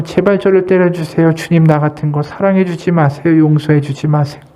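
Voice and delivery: normal talking voice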